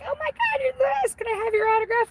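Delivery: very high squeaky mock fans; gushing fans. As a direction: gush like a star-struck little animal. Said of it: "they all come running up to you like".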